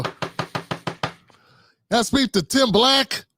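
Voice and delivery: lightly voiced polite visitor, slow and high; a mock-formal stranger asking for him; caller at the door. Imagined Democrat knocking at his door, mock-polite voice.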